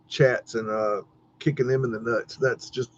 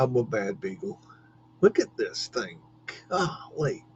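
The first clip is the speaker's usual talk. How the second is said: usual speaking voice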